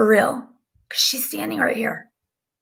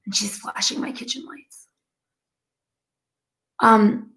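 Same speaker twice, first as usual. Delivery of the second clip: ordinary talk, nothing marked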